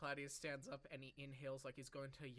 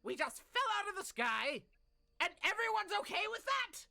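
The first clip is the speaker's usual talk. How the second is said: irritated; 9 semitones higher, 9 dB louder than usual. Acted. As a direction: rant like a fed-up guildmaster. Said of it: "very irritated right now".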